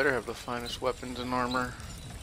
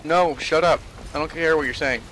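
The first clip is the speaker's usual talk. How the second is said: loud, irritated shut-up; annoyed; 2 semitones higher, 9 dB louder than usual. Real, not acted. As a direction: snap at someone chattering. Snapping at chatty game character.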